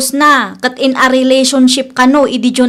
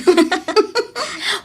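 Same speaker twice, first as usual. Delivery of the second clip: giggle; 5 semitones higher, 6 dB quieter than usual. Acted. Friends teasing each other.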